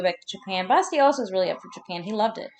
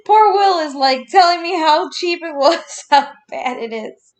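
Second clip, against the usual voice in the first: much higher and louder, breaking into laughter; can't keep a straight face, slower; laughing through it; 8 semitones higher, 6 dB louder, slower than usual. Real, not acted. Laughing while retelling Will's cheap wine apology.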